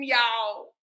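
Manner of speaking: ordinary delivery, nothing marked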